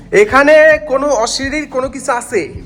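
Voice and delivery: loud, slow, high call into the dark room; calling out to spirits. Real, not acted. Ghost hunter loudly calls any spirit in the abandoned washroom.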